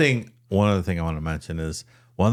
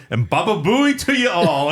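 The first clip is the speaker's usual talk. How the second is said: higher-pitched imitation Jamaican prank caller; Jamaican accent; 9 semitones higher, 3 dB louder than usual. Prank caller signing off on live news, Jamaican accent. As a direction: sign off high in a mock Jamaican accent. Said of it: "in this Jamaican accent".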